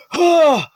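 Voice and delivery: gasping cry. Acted. Dad wakes up gasping.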